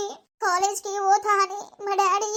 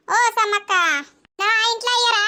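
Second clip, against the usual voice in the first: calling out; 3 semitones higher than usual. Acted. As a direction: hail the neighbour. Calling out to neighbour. (పక్కింటామెను పిలుస్తూ గట్టిగా)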